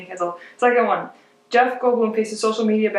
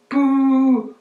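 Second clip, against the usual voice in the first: loud high playful boos at the rival team; mock booing; 5 semitones higher, 5 dB louder than usual. Vikings fan booing the Packers player, loud mock boo. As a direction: boo them like a heckler in the stands.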